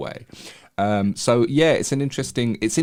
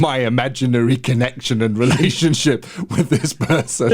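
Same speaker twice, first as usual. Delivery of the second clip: louder, higher, laughing through the words; amused, laughing; 4 semitones higher, 5 dB louder than usual. Real, not acted. Telling colleague about an imagined connection crumbling, laughing. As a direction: laugh at your own embarrassment. Laughing at own embarrassing story.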